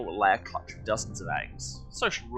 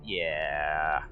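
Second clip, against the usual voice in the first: long, low drawn-out yeah; sarcastic; 6 semitones lower than usual. Real, not acted. Reader reacting to a libertarian game developer, sarcastic. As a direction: let it drag, lower, unimpressed.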